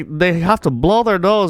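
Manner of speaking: ordinary delivery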